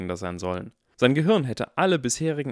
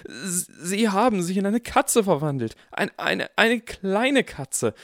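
shocked; 9 semitones higher, 4 dB louder, slower than usual. Acted. Harry shocked by cat transformation.

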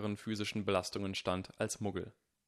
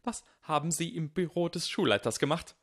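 higher, louder, almost shrieking; near shriek; 7 semitones higher, 4 dB louder than usual. A narrator's reading. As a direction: demand it, voice climbing to a screech. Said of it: "steigerte sich fast zu einem Kreischen".

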